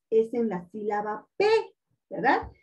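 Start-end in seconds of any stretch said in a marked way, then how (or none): none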